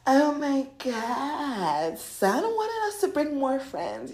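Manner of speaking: high, drawn-out gushing; hammed-up delighted exclaiming; gushing excitement. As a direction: gush slowly like a thrilled party guest. Voices the money talking excitedly.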